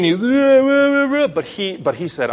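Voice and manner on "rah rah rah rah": high mock wailing rah rah; playful imitation of a child's cries; imitated child crying. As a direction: wail like a scared little boy. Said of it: "But he was crying".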